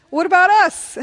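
loud, high-pitched protest; indignant disciple piping up loudly; Peter. Acted. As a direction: cry out as if left out. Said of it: "Peter says".

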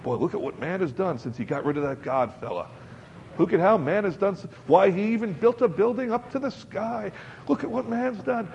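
high, hammed-up bragging about man; mocking the boastful world, hammed-up pride; mock boasting. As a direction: brag like a proud showman. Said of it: "You know".

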